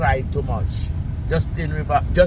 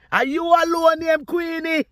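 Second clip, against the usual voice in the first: high taunting question. Real, not acted.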